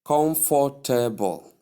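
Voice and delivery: every syllable of "comfortable" full, kom-for-tay-bol; full mispronunciation. Shown as a demonstration. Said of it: "Sometimes pronounced fully as".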